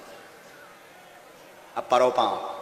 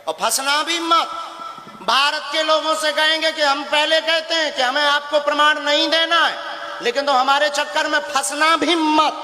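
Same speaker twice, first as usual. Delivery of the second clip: a guru daring his critics from the stage; raised warning; 7 semitones higher than usual. Real, not acted. Guru warns the public. (ऊँची आवाज़ में चेतावनी)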